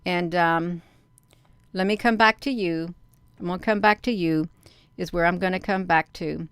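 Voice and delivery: normal talking voice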